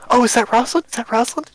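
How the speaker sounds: high, loud excited gasps of recognition; excited crowd voices; excited crowd murmur. Acted. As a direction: bystanders thinking they spot her. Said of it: "people go".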